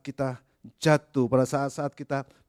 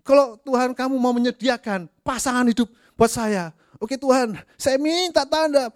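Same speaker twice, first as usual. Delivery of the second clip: much higher, fussy pleading; mock prayer for a spouse; 12 semitones higher, 6 dB louder than usual. Preacher mocks a believer asking God for a sign about a spouse, high and fussy. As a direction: bargain with God, then panic. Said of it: "Kalau Anda mengatakan".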